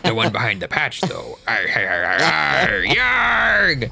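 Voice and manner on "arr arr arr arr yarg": pirate growls. Pretend. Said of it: "done with the screaming".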